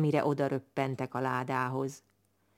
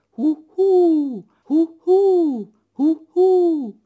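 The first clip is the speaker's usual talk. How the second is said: high, loud falling hoots; owl hooting; 13 semitones higher, 10 dB louder, much slower than usual. Acted. Long-eared owl trying out its new song, loud high hoots. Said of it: "mondogatja a fülesbagoly".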